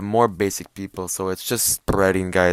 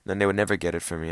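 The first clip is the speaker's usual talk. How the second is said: usual speaking voice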